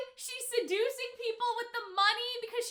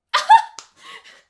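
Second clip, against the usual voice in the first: a sudden high cackling laugh; loud burst of laughter; 14 semitones higher, 17 dB louder than usual. Real, not acted. Reactor bursts out laughing at the reveal.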